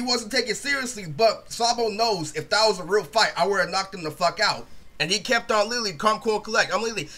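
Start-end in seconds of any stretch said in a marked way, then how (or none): none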